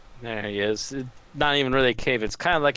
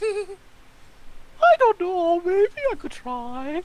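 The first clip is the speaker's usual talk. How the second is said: very high falsetto voice; slow, squeaky falsetto; much slower than usual. Pretend. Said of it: "[name] doing a falsetto".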